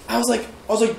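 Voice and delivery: normal talking voice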